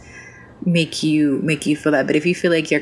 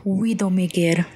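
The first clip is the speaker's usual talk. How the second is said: slow, half-hearted French-accented femme fatale; Widowmaker; 3 semitones higher than usual. Shown as a demonstration. Streamer doing a game character on request, half-ass French-accented voice. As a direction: draw the name out with a rough French accent. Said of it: "Give me half-ass Widowmaker".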